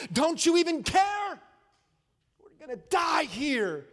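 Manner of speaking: high, loud, pleading shout; a frantic believer crying out to God; desperate cry to God. Acted. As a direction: beg heaven like you're drowning. Voicing a desperate prayer.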